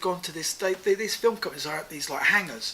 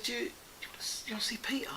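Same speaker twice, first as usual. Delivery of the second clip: hushed aside; 4 semitones higher, 11 dB quieter, much faster than usual. Retold or imitated. Quietly offering Jonathan an introduction to Peter, hushed. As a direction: ask it under your breath. Asking friend discreetly at a party.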